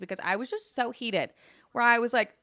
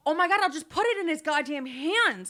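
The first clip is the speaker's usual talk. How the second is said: exasperated; 8 semitones higher, 3 dB louder than usual. Retold or imitated. Fed-up retort to mom.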